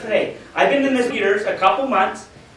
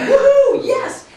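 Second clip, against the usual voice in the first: a whoop pitched much higher and louder; excited whoop; 7 dB louder, much slower than usual. Retold or imitated. Dan cheering the big recruit, whooping excitedly. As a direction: cheer it out like a celebration. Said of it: "Dan's like".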